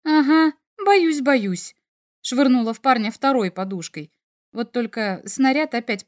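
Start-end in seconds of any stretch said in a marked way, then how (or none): 0.0-1.8 sarcastic teasing, louder; mock fear, thrown back loud. A narrator's reading. Mocking his threat.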